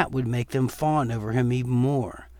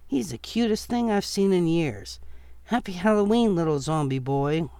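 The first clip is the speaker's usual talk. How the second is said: put-on higher, fluttery elderly woman, amused; sweet old lady voice; 6 semitones higher than usual. Elderly witch-costumed woman cooing at the zombie boy, high and sweet. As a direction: coo like a delighted grandma.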